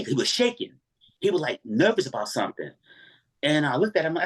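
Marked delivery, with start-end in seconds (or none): none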